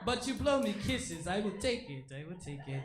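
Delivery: hammed-up African accent of an eager newcomer; put-on African accent. Newcomer on a first day in America, put-on African accent. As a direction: play a wide-eyed arrival with an African accent. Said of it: "I don't sound like that".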